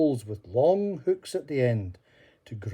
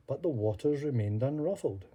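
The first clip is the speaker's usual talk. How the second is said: ordinary delivery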